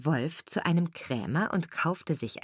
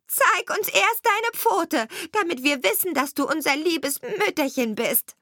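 put-on goat kids demanding; 10 semitones higher than usual. A narrator's reading.

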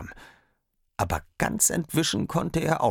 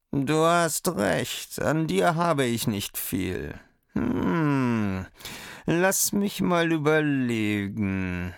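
sly fox; 8 semitones higher, slower than usual. A narrator's reading. Fox voiced, bargaining.

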